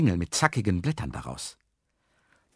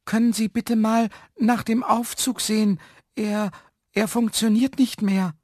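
much higher, insistent call for help; high, even, slightly raised voice; high-voiced Herr Jaromir; 12 semitones higher, 3 dB louder than usual. A narrator's reading. Trapped man asks for the lift to be checked, high-pitched plea.

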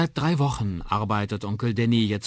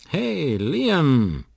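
loud, high, drawn call; 9 semitones higher, 9 dB louder than usual. Acted. Uncle nudges downcast Liam, calling out cheerily. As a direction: call the boy playfully. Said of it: "ruft Onkel Danny".

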